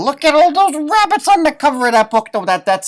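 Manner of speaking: high, loud, delighted exclamation; thrilled at the rabbits on the cover; excited. Real, not acted. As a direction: gush over the cover picture. Excited over the book cover.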